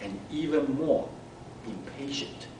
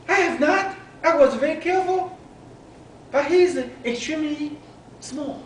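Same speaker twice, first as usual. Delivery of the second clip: high, quick protest; defensive mom; 12 semitones higher, 4 dB louder, faster than usual. A narrator's reading. Mom protesting to Abby, high and fast. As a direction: brush off the blame, fast and high.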